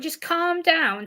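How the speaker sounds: slow, put-on soothing; mimicked soothing advice. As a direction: imitate someone slowly telling you to calm down. Imitating people who offer advice.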